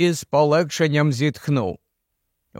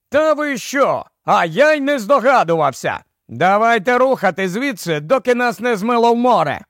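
mild sarcastic yell, pitched up; sarcastic retort; 5 semitones higher than usual. Acted. Barney retorts to the professor over the surf, sarcastic call. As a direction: snap back over the waves.

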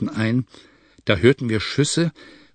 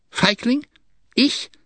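very high, incredulous questions; indignant cry; 13 semitones higher, slower than usual. A narrator's reading. Chalef answering the charge of cowardice, indignant cry. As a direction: throw the insult back, pitch leaping up. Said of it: "rief Chalef".

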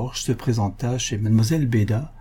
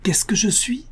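put-on high-pitched, puzzled old aunt; deaf aunt's query; 11 semitones higher than usual. A narrator's reading. Aunt feigning deafness to the suitor, high puzzled query. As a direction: ask it high, as if hard of hearing.

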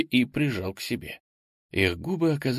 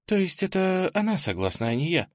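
indifferent tone; 8 semitones higher than usual. A narrator's reading. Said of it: "совсем другим равнодушным тоном".

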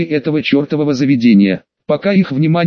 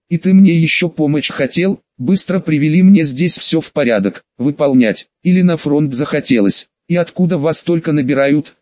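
usual speaking voice

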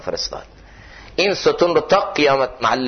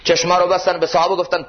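normal talking voice

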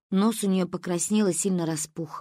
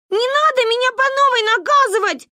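very high, loud, rapid protest; yelling; 6 dB louder, faster than usual. A narrator's reading. Sister being dragged off to be punished, yelling. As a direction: holler frantically against the punishment. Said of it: "орала сестра".

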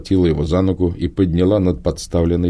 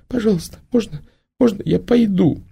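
put-on high, weeping girlish begging; crying plea. A narrator's reading. The girl begs Prima to let her leave, crying. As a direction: beg through tears to be let go. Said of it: "проплакала девочка".

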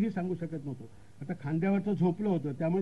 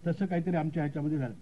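usual speaking voice

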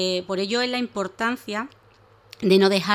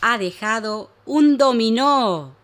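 excited reveal; 3 semitones higher, 7 dB louder, much slower than usual. Acted. Narrator reveals present.

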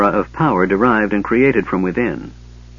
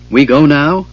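higher and louder, worried little question; anxious; 5 semitones higher, 7 dB louder than usual. A narrator's reading. Bupu the gully dwarf asking Tanis, anxious. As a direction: ask eagerly and nervously. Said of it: "looking at Tanis anxiously".

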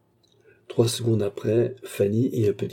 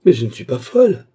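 loud, high protest; indignant exclamation; 5 semitones higher, 10 dB louder than usual. A narrator's reading. Patient protesting to doctor and maid, loud exclamation. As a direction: cry out in denial. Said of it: "exclama Baccarat".

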